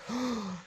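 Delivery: sharp imitated gasp; mock gasp. Miners coming upon the supposed wizard, mock gasp. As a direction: gasp in pretend astonishment. Miners' awed reaction acted out.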